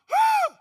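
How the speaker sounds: shouted high party whoop. Acted. Telling the crowd about wild nights, shouted whoop.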